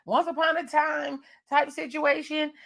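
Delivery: hyped-up high voice expecting a fairy tale; excited. Pretend. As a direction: gush like you're settling in for a cute story. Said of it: "You're like".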